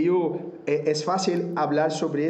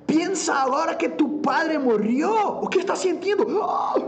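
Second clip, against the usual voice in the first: high, urgent, hammed-up shout; re-enacted pushy shout, pitched way up; provoking coach; 12 semitones higher than usual. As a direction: goad a singer hard for raw emotion. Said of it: "Y yo estaba provocando".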